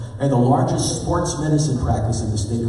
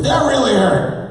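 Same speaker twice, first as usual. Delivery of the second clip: high, loud petulant complaint; whiny teen girl; 12 semitones higher, 4 dB louder than usual. Retold or imitated. Teen patient telling the doctor again, high petulant whine. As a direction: whine it like a sulking teenager.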